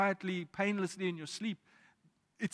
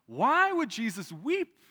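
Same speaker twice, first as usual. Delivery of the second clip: emphatic question; 5 semitones higher, 6 dB louder, slower than usual. Real, not acted.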